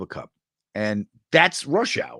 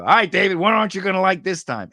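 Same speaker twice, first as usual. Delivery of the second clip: louder, higher mock-cocky jab at the director; playful dig at the casting director, louder and higher; cocky quip; 8 semitones higher, 6 dB louder than usual. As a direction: toss it off with a swagger. Cheeky line to the casting director.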